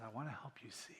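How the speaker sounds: soft unvoiced whisper, gentle and intimate. A narrator's reading. Jesus whispering to the blind man, gently. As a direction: breathe it like a tender secret.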